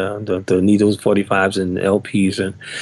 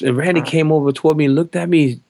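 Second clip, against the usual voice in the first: usual speaking voice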